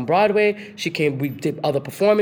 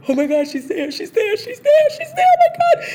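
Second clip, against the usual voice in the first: excited panic; 6 dB louder than usual. Retold or imitated. Spotting her idol while in lift.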